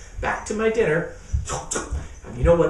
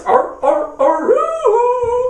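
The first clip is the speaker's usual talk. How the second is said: high loud wolf-like aroo; howling ringtone; 7 dB louder, much slower than usual. Acted. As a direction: howl it like a ringing phone. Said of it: "That is the weirdest sound".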